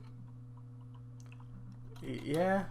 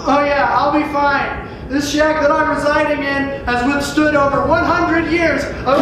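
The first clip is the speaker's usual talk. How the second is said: much louder and higher, yelling; yelling over wind; 6 semitones higher, 9 dB louder than usual. Acted. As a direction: shout over a howling windstorm. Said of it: "why I'm yelling right now".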